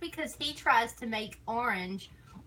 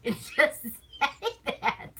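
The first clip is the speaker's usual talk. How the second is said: laughing; 8 semitones higher, 5 dB louder, much slower than usual. Real, not acted. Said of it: "laughing at own rant".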